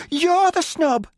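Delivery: high, loud indignant retort; angry. A narrator's reading. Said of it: "she said".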